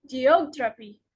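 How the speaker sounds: geOGraphy, weight on OG; stress before suffix. Shown as a demonstration. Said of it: "the syllable just before the suffix".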